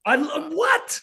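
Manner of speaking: high-pitched incredulous outburst; offended. Real, not acted. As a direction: react as if personally insulted. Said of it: "look how [name] got offended".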